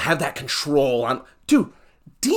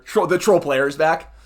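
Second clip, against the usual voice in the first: ordinary delivery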